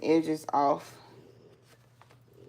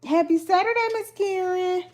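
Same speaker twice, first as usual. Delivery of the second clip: bright greeting, much higher; 11 semitones higher than usual. Real, not acted. Host greeting viewer Miss Karen in chat, high and cheery. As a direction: sing out a high cheerful hello.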